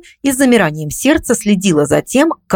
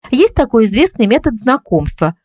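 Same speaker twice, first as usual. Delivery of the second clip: ordinary delivery, nothing marked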